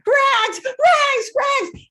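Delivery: high, loud call repeated, falling each time; shouting a name. Retold or imitated. Boy yelling for his runaway dog out the classroom window, loud and high. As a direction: yell the dog's name out the window. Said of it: "he starts shouting".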